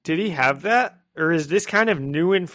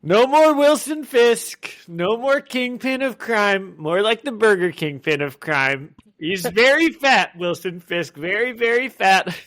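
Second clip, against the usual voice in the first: bad Donald Trump; 7 semitones higher, 4 dB louder than usual. Podcaster reading villain's victory speech to co-host, bad Trump impression. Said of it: "I'm gonna do my bad Trump impression".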